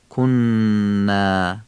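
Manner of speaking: کنا very slow, n hummed long, thin alef; nasalised double n. Shown as a demonstration. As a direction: hold the doubled n in کنا through the nose. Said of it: "نون کنا مشدده غنه داره". (کنا با نونِ مشدد و غنهٔ کشیده)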